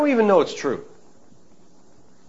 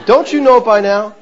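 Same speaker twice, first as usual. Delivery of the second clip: louder, through a laugh; amused repeat; 6 semitones higher, 8 dB louder than usual. Retold or imitated. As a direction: relish the daughter's line. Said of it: "that's what she said to me".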